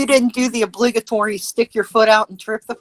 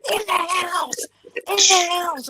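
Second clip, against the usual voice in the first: high, mock shouted cop commands; imitating cops yelling; 10 semitones higher, slower than usual. Cops yelling at crouched people to get in the house. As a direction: bark it like a cop clearing people out.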